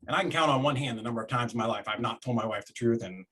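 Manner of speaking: ordinary talk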